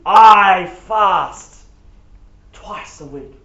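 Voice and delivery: self-satisfied praying voice, stretched out and showy; a pompous Pharisee's boastful prayer, with big loud bursts; smug Pharisee. Acted. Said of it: "let me read it in a different voice".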